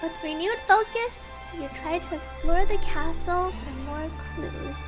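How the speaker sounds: ordinary talk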